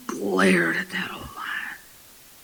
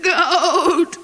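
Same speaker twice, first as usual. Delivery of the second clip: drawn-out word with a goat's wobble; bleating cub; 4 semitones higher, 3 dB louder than usual. Acted. Cub stubbornly answers the lion, bleating. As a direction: bleat the word like a kid goat. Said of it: "shook his head and said".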